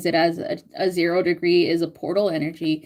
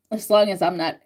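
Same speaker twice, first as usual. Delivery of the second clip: ordinary delivery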